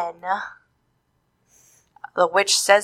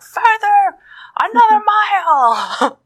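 high, loud, slow shout; yelling witch; 12 semitones higher, 5 dB louder, slower than usual. Retold or imitated. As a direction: yell him further away. Witch yells at Donald.

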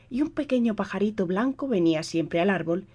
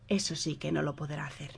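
thinking aloud; 3 semitones lower, 9 dB quieter than usual. A narrator's reading. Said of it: "pensó para sí".

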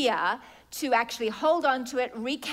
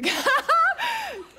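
sudden loud high laugh; high burst of laughter; 7 dB louder than usual. Real, not acted. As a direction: crack up at the joke. Preacher laughs at congregant.

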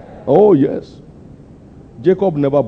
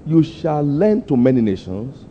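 slow solemn scripture recital; preaching; 4 semitones lower, slower than usual. Acted. Preacher reciting scripture to the congregation, slow and solemn. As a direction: intone the verse slowly like a pulpit reading.

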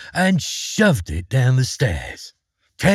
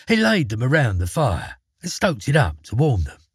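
ordinary delivery

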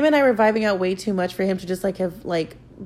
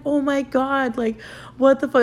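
shocked; 7 semitones higher, slower than usual. Retold or imitated. Shocked reaction to friends.

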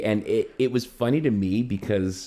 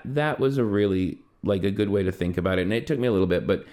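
ordinary talk

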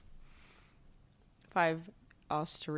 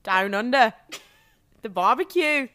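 put-on a light, jokey Australian accent; 3 semitones higher, 14 dB louder than usual. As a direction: toss off stock Australian phrases in a mock Australian accent. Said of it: "Can you do an Australian".

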